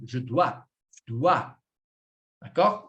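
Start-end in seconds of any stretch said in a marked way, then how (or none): 0.1-1.5 low "Je dois", weight on DOIS; final-syllable stress. Shown as a demonstration. Teacher shows French word stress, landing on "dois". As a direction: lean on the last syllable, "dois". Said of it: "a última sílaba vai sofrer a entonação".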